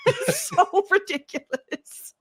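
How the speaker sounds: slow, high, laughing through the words. Real, not acted. Cracking up over the absurd needle, laughing. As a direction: giggle it out in disbelief.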